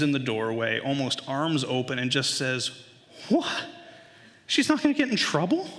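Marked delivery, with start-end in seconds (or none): 3.3-5.7 put-on high-pitched child's protest; indignant little boy. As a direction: imitate a boy outraged at the unfairness. Son indignant sister escapes punishment.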